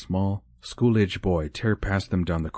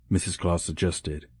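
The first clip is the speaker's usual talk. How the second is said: usual speaking voice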